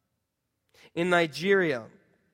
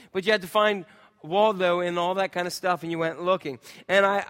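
normal talking voice

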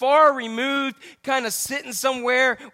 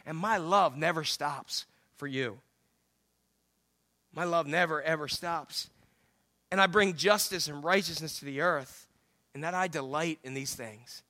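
soft, lower, unhurried reassurance; tender; 6 semitones lower, 7 dB quieter than usual. Acted. God promising love to the congregation, softly and tenderly. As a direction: drop low and speak it gently.

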